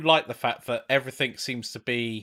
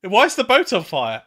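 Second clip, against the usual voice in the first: alarmed shout; 7 semitones higher, 8 dB louder than usual. Retold or imitated. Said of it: "Jan's like".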